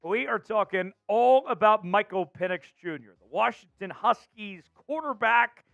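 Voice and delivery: energetic, emphatic sports host delivery; announcer show open. Acted. Host welcomes viewers to Seahawks show, energetic announcer. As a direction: punch key words like a show host.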